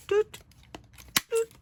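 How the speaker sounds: squeaky little "doot, doot" way up high; high playful doots. Real, not acted. Crafter amusing herself at the table with high doots. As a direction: toot it out like a tiny horn.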